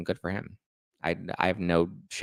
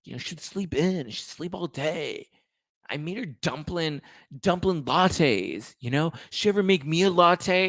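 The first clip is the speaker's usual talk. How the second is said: high, breathy, whiny Sandoval voice; Sandoval impression; 8 semitones higher than usual. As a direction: do your breathy Sandoval, sulky and self-pitying. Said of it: "as breathy as my version of Sandoval".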